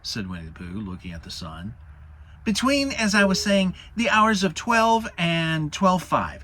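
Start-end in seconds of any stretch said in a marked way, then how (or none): 2.4-6.4 higher, louder timid little pig making excuses; squeaky Piglet inventing a reason to leave; nervous Piglet. Acted. Narrator voices Piglet.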